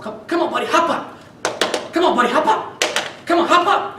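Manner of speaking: high, rising coaxing voice building up; coaxing the dog. Retold or imitated. Blind owner coaxing her overheated guide dog: high, building coaxing. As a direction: sweet-talk a stubborn dog along, pitch climbing. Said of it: "So I'm trying to encourage the dog".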